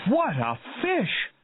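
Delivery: high, slow wonder; amazed cry. A narrator's reading. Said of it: "cried Hooper".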